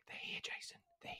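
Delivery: hushed whisper warning. Retold or imitated. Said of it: "Like, whispering".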